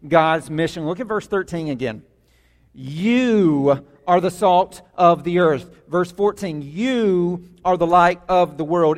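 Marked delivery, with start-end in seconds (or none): 2.7-5.7 "You" hit hard, slowed way down; stress on you. Shown as a demonstration. Preacher to his church, driving the "You" home slowly. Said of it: "this is emphatic". 6.6-9.0 "You" hit hard, slowed way down; stress on you. Shown as a demonstration. Preacher to his church, driving the "You" home slowly. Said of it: "this is emphatic".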